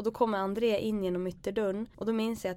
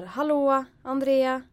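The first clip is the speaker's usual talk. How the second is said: loud, higher call through the house; 6 semitones higher, 8 dB louder, much slower than usual. Retold or imitated. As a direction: call out nervously to an empty house. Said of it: "jag börjar ropa så här".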